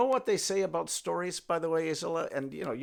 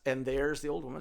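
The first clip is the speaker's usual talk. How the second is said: plain reading voice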